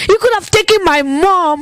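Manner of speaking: high-pitched retold protest to God; accusing. Grieving woman bargaining angrily with God, high-pitched accusation. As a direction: hurl the reproach upward, without shouting. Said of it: "I will curse God out".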